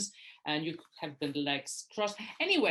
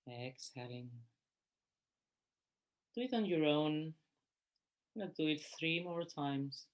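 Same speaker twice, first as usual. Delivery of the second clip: guided meditation; 3 dB quieter than usual. Real, not acted. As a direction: guide them gently through each breath, unhurried. Leads a breathing meditation.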